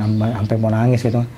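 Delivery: normal talking voice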